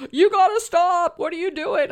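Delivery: high, loud soapbox scolding; bossy yelling. Acted. Podcaster on her soapbox at the listener, bossy yelling. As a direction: yell it at them like a bossy scold. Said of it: "I'm yelling at you".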